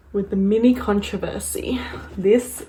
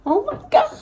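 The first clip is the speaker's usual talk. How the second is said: high delight; faster than usual. Real, not acted. Sudden delight.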